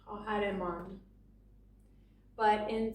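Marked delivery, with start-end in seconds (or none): 0.1-1.0 khahar man, ending on a clear n sound; with the added n. Shown as a demonstration. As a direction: close the word man with a firm n. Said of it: "we're gonna just add a nun here".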